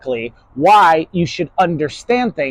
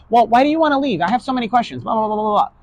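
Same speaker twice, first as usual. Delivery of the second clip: higher nagging voice; whiny questioning; 6 semitones higher, faster than usual. Retold or imitated. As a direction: pester with endless questions. Questioning person stalling.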